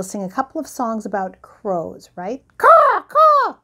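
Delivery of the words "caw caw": high, loud bird squawk; noisy raven. Pretend. Grandparent's raven butts into the bedtime show, noisy squawking. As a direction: caw out like a pushy crow. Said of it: "he's really a noisy bird".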